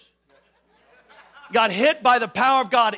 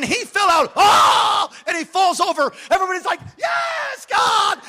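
loud, excited shout; a preacher's shout reliving the moment; shouted retelling; 12 semitones higher, 7 dB louder than usual. Retold or imitated. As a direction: cry it out like the crowd did. Preacher acts out the fall, shouting.

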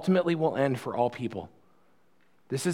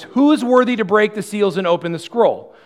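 higher and louder herald call, a little less than the first; loud proclamation; 7 semitones higher, 7 dB louder than usual. Acted. Angel proclaiming to all creation, loud but slightly restrained. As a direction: cry it out like an angel, a touch held back. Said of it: "proclaiming in a loud voice".

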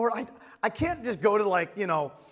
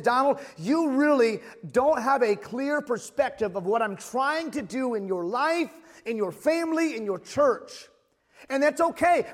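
louder fervent preaching, unhurried; preaching God's voice; 6 semitones higher, 4 dB louder than usual. Acted. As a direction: speak as God reassuring him. Voices God speaking to him.

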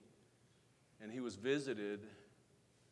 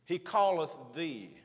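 slow, loud He calleth thee; emphatic refrain; 3 semitones higher, 6 dB louder than usual. Acted. Preacher driving home the verse, slow and emphatic. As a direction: lean on calleth, deliberately.

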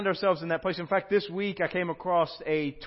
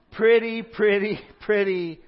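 pleading pretty, starting high and loud then easing down; a child begging for a treat, higher and louder; escalating plea; 3 semitones higher, 6 dB louder, slower than usual. Acted. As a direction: beg harder on each pretty. Acted pleading text reply.

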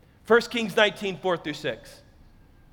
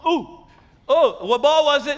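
half-asleep son; 5 semitones higher, 4 dB louder than usual. Retold or imitated. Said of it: "he's just laying there like".

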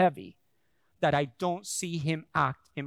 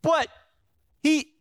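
flustered protest; 9 semitones higher, 5 dB louder than usual. Retold or imitated. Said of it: "I literally sit there like".

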